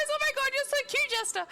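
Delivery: very high, loud gushing squeal; excited squeal. Real, not acted. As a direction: gush like a fangirl. Gushing at chatter Jester.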